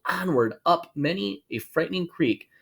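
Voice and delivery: ordinary delivery, nothing marked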